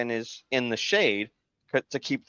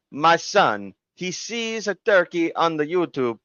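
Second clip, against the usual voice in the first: higher, hammed-up Italian accent; 6 semitones higher than usual. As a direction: explain it like a caricatured Italian dad. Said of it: "this Italian guy".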